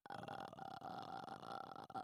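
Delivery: long, low creaking throat rattle; scary Grudge noise. Shown as a demonstration. Girl imitating The Grudge's very scary noise, long creaky croak. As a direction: croak it out slowly like a horror-film ghost. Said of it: "that very scary noise".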